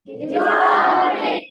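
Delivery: determine shouted slowly, de-TER-mine; stress on TER. Shown as a demonstration. Student drills the word loudly: de-TER-mine. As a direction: call out determine, slow, hitting TER. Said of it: "So one more time".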